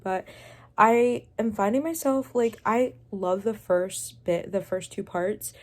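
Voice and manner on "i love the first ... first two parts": ordinary delivery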